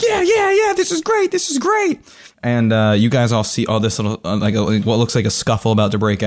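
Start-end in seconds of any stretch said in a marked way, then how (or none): 0.0-2.0 very high, excited crowd voices; high-pitched onlooker cheering. Acted. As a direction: egg on the fight. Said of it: "They're like".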